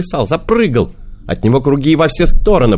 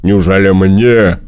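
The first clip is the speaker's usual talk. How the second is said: bear voice; 5 semitones higher, 6 dB louder than usual. A narrator's reading. Bear begs cat for fish.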